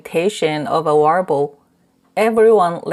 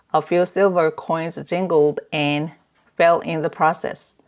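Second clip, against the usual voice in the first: ordinary delivery, nothing marked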